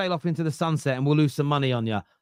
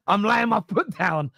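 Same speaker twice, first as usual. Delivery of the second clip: normal talking voice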